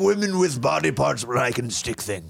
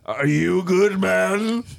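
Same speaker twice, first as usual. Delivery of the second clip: haughty echo of a casual question; pompous, rising rich-man question; affected rich-man voice; 4 semitones higher, 3 dB louder, much slower than usual. Acted. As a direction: throw it back in an affected upper-crust voice. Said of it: "Why are you talking like that?".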